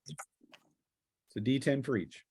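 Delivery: usual speaking voice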